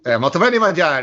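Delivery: joking Italian accent, played for laughs; mock Italian accent. Cohost riffing on Kermit's bad Italian accent. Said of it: "really bad Italian accent on purpose".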